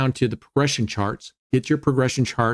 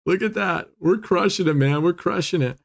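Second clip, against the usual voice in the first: excited; 5 semitones higher than usual. Real, not acted. Big roulette win on stream.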